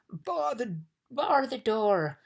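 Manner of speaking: long, high, loud cry; shouting. A narrator's reading. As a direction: yell a frantic order, stretched out. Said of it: "shouted the bug".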